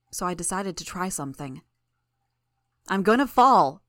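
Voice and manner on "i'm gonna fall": loud, raised-pitch cry of alarm; sudden panicked scream, narrated. A narrator's reading. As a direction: shout it out like you are about to drop. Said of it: "I screamed".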